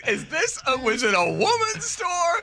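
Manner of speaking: high, slow mock-naive voice; high, slow mock confusion about the shirt; mock innocent question. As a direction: play dumb in a high, slow voice. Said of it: "I was like".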